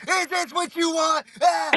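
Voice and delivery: high, pushy mock challenge. Jimmy daring the guys after dropping his towel, high mock dare. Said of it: "he's like".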